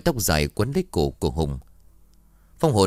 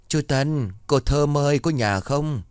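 higher, calling across the yard; pitched-up call to someone indoors; 7 semitones higher, slower than usual. Acted. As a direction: hail the neighbours from outside the gate.

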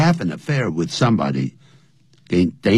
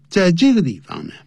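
ordinary talk, nothing marked

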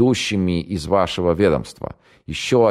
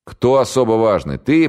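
contemptuous jab, much higher and louder on the last word; презрительно; 7 semitones higher, 5 dB louder than usual. Acted. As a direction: sneer at him. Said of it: "презрительно бросил Октябрьский". (майор презрительно шпыняет пленного)

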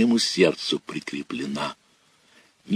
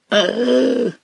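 much higher, drawn-out desperate howl; roar. Acted. Boy pulled into the water, drowning howl. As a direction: roar as he goes under. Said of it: "взревел Санька".

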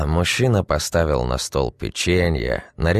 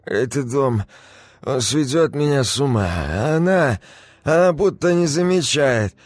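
put-on drunk rambling; 10 semitones higher than usual. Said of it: "бубнил мужчина".

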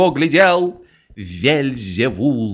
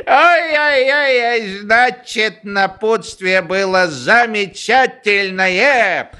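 joyful guffaw; 8 semitones higher, much slower than usual. Acted. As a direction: roar with laughter. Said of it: "Радостно захохотал генерал".

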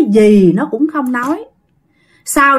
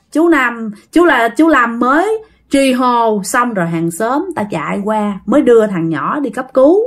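ordinary delivery

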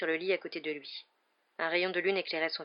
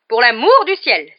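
slow, high, very loud exclamation; enraged; 6 semitones higher, 17 dB louder, slower than usual. A narrator's reading. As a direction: explode with exasperation. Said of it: "folle de rage". (folle de rage)

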